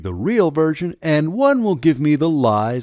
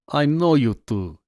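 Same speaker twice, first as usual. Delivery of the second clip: put-on knowing Russian-accented officer; 4 semitones higher than usual. A narrator's reading. Officer recognizes the boys, in a Russian accent. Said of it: "he asked in a heavy Russian accent".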